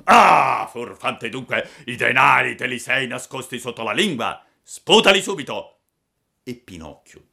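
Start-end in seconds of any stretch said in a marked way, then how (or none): 0.0-5.8 loud accusing assassin; angry robber. Acted. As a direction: bark at him to spit the coins. Robber finds hidden coins.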